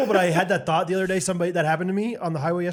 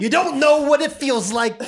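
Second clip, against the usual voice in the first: high, slow attempt at a rapper's voice; 7 semitones higher than usual. Player answering the tease, botched impersonation. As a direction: drag it out high, imitating a rapper. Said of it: "I can't do an [name] impression".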